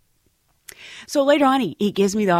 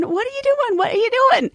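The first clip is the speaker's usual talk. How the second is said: much higher, rising panic; alarmed inner panic. Retold or imitated. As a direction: squeak it as he leans in.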